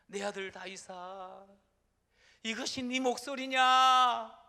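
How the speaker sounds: slow, rising into a loud cry; a broken king calling out to his son; voiced Saul crying out loud. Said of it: "소리를 높여서 웁니다".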